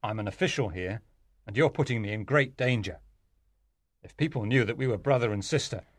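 low, gruff man's warning; low-pitched rough impatience. A narrator's reading. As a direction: tell her off roughly, keeping the voice low. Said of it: "he said roughly".

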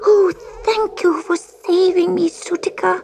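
high, tearful kitten voice; hammed-up tearful little cat; sobbing. A narrator's reading. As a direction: sob out the gratitude. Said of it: "sobbed Gobelino".

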